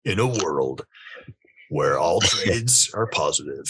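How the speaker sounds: dramatic trailer narrator; movie trailer voice. Pretend. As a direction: announce it like an epic film trailer. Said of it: "that movie trailer voice".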